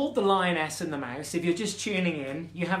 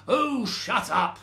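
loud, drawn-out mother's roar; roaring lioness; 4 semitones higher, 4 dB louder, slower than usual. Acted. Lioness mother silencing her squabbling cubs, roaring. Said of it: "roared Simba".

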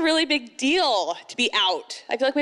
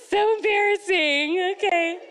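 high, drawn-out embarrassed squeal; hammed-up mortified mom; mock embarrassment; 7 semitones higher than usual. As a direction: cringe through it in a high, slow voice. Acted embarrassed mom at gas pump.

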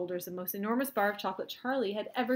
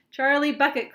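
high, loud call by name; cried out; 7 semitones higher, 14 dB louder than usual. A narrator's reading. Wonka singling out Charlie, cried out. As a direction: call the boy out loudly. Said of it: "cried Mister Wonka".

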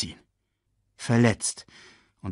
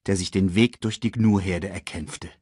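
usual speaking voice

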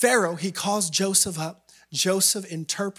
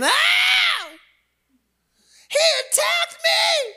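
high shrill-pitched fake scream, much louder; mock scream; 5 dB louder, slower than usual. Preacher voices Potiphar's wife crying out, high mock scream. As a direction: shriek it like a false accuser. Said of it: "she starts screaming".

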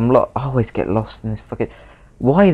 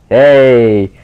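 shout; 4 semitones higher, 8 dB louder than usual. Pretend. Shouting at enemy.

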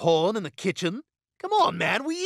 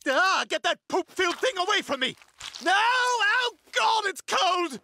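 disgusted yelling; 13 semitones higher than usual. Acted. Recoiling from the diaper.

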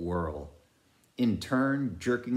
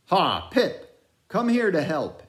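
calling out; 11 semitones higher, 7 dB louder than usual. A narrator's reading. Ahab calls out to Pip.

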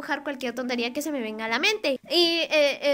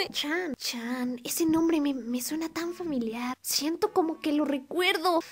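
mock dreamy; 8 dB quieter than usual. Pretends to vaguely remember Chan.

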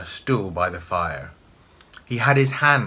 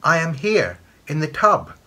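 high, raised little voice; high, louder answering call; answering washing water; 7 semitones higher, 5 dB louder than usual. A narrator's reading. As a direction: pipe up high in reply. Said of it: "the washing water answered".

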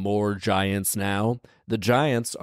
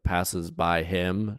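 ordinary delivery

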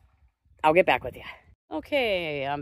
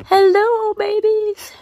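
much higher, louder sing-song hello; 12 semitones higher, 5 dB louder, slower than usual. Real, not acted. Greeting her koi as babies, high and thrilled. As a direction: call out to them like delighted babies.

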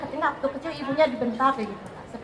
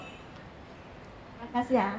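soft thanks; 2 semitones lower, 13 dB quieter, much slower than usual. Real, not acted. Questioner thanks softly.

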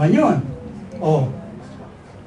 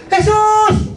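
a high, loud, desperate call; sudden shout; 6 dB louder than usual. Acted. Blind beggar calls out to Jesus passing by, high desperate shout. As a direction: cry out to be heard over the crowd. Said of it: "Sumigaw siya bigla".

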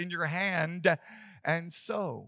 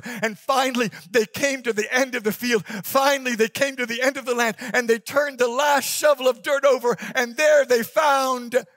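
louder, higher, rising storyteller build; building excitement; 5 semitones higher, 7 dB louder than usual. A narrator's reading. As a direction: build the suspense up to the climax.

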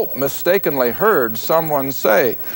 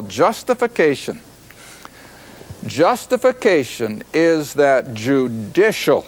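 slow dictation; 2 semitones lower than usual. Shown as a demonstration. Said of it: "I'll try to say it slowly enough".

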